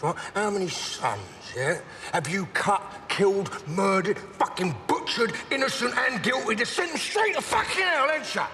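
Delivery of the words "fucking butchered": ordinary delivery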